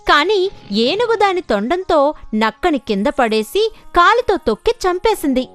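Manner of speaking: ordinary delivery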